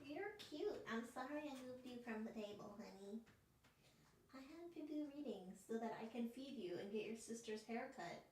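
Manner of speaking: soft cooing to a pet, off to the side; pet-talk. Real, not acted.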